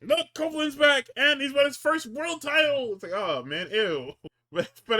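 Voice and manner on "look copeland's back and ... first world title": high, loud announcer hype; mock hype. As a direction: sell it like a fake-excited announcer. Mock announcer hyping Copeland's win.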